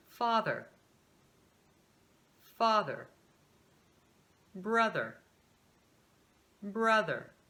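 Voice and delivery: "mother, father, brother, sister, doctor, super" each twice, ending in a light er; unstressed American er. Shown as a demonstration. Teacher drilling unaccented syllables for repetition, light final American er. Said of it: "A sílaba não acentuada".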